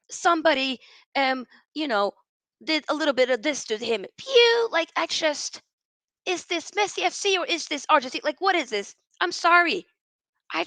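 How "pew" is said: high, loud squeaky "Pew!"; mock whistle noise. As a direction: zap it out high and loud, mocking. Mocking Messi going down.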